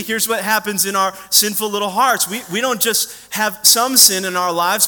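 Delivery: normal talking voice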